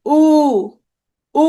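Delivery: উ held long, tongue back and down. Shown as a demonstration. Teacher repeating the back vowel for students, long উ. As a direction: sustain each উ from the back of the mouth. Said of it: "পেছনের দিকে, নিচের দিকে".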